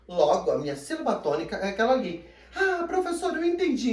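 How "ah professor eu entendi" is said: high-pitched eager pupil voice; student catching on. Acted. Student telling the teacher they understood, high excited voice. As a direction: play a pupil who suddenly gets it, high.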